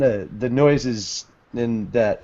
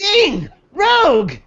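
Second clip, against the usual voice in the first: calling out names; 5 dB louder than usual. Acted.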